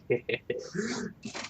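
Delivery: soft, sneaky little chuckle; villain's sly snicker. Acted. Co-host acting out Sebastian secretly evil, soft sly snicker. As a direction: snicker quietly like a secret schemer. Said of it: "he's just like".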